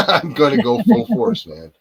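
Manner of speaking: mock hammed-up bravado; eager zombie-actor boast. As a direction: announce it like a gung-ho method actor.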